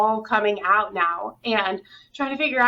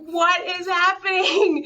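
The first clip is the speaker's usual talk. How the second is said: higher, slowed, mock-bewildered question; retold bewilderment; 8 semitones higher, slower than usual. Caught off guard by tears in yoga class, higher and slow in retold bewilderment. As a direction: replay the shock of suddenly crying. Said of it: "I just started bawling".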